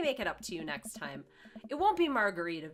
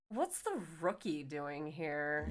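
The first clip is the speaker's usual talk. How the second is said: a hint of disdain, slow and lower; low, slow, mildly scornful question; lightly voiced surly wife; 5 semitones lower, 5 dB quieter than usual. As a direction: size up the newcomer, low and slow. Acting Mary, hostile wife.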